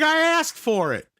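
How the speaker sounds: high, slow retold kid protest; going off. Young kid defending Ryan to Pat, going off. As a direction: stretch it out as an indignant kid. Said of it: "he's just going off on Pat".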